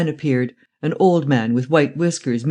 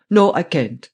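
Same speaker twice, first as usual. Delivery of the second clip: put-on firm, German-accented refusal; vehement refusal; 3 semitones higher, 3 dB louder, slower than usual. A narrator's reading. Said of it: "then vehemently".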